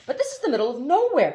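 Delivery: put-on fast, high, upset boy; exasperated. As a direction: blurt it out in dismay. Haroun exasperated.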